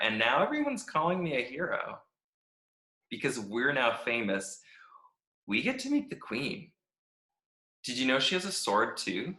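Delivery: upbeat, chirpy diary reading; a happy-go-lucky stick who loves every minute; cheerful Stick. Acted. Stick reads his cheerful diary.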